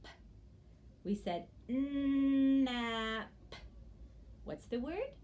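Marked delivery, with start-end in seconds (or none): 0.0-0.1 "p" as a bare puff; isolated sound. Shown as a demonstration. Teacher gives nap's last sound, "p" popped. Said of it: "the last sound is". 1.7-3.3 "Nap" stretched, with a separate final p; very slow. Shown as a demonstration. Teacher models slow speech for children, "Nap" drawn out. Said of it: "say it very slowly".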